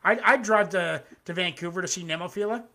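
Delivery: ordinary delivery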